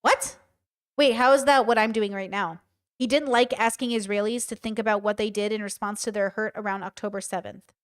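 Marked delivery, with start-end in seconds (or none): none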